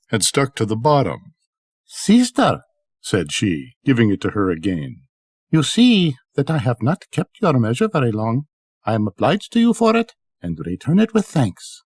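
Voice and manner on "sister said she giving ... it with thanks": a lightly played courteous sister-in-law; polite wife. Ali Baba's wife returning the measure to her sister-in-law, sweetly polite.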